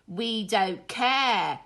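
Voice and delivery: a cheeky cub talking back; rude yell. Acted. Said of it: "yelled one of the cubs rudely".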